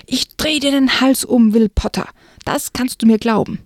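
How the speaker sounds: angry threat. Acted. The girl threatening Will after his jeers, heated threat. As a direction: threaten him through embarrassment.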